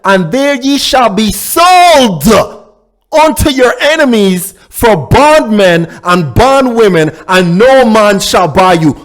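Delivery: normal talking voice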